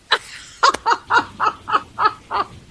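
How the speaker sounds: high, airy burst of laughter; breathy high-pitched laugh; laughing at own joke. Real, not acted. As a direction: crack up at your own joke. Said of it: "I make myself laugh".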